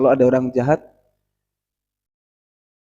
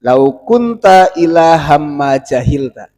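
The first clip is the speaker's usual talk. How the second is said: iblis's slow retort; 8 dB louder, much slower than usual. Acted. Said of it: "iblis jawabnya nggak gitu".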